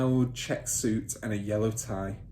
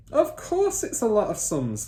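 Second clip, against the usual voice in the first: higher scornful retort; scoffing father; 11 semitones higher, 5 dB louder than usual. Acted. Father scoffing at son's complaint, scornful. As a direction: brush off the whining.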